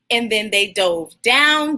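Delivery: put-on warm, steady reading to children; story read aloud. Host reading Jabari Jumps to young viewers, gentle storytelling. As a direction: read the picture book to the kids. Reads picture book aloud.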